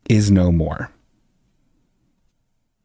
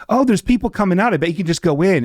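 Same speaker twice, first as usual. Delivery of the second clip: chirpy helper; 7 semitones higher, faster than usual. Retold or imitated. Imitating the door lady.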